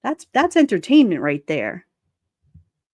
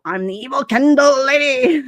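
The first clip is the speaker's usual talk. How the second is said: high, loud mock-villain proclamation; hammed-up self-announcement building louder; evil candle lady; 6 semitones higher, 5 dB louder than usual. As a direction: mockingly play a self-declared evil lady. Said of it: "and then they can go".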